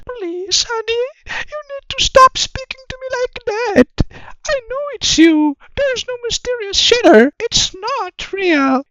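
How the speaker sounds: put-on very high-pitched squeaky girlfriend voice; mocking falsetto girlfriend. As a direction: play the exasperated girlfriend, voice way up. Skit: girlfriend voiced by host.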